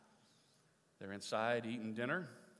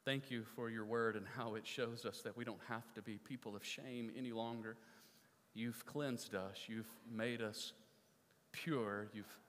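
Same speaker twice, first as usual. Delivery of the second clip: closing prayer; 4 semitones lower, 6 dB quieter than usual. Real, not acted. Pastor praying softly for his congregation at the close of the sermon. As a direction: pray quietly over the congregation.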